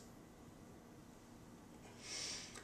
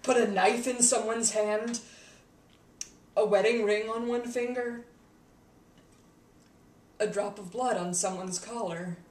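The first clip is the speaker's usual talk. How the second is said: raised, louder, exasperated rant; worked-up rant; 3 semitones higher, 5 dB louder than usual. Acted. Explaining how simple killing is, worked-up rant. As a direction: list it off forcefully, pitched up. Ranting about how easy it is.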